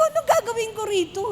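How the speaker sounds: playful fake crying over the wrong shoes; mock crying. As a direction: whine it like a mock lament. Repeats same question, half-crying laugh.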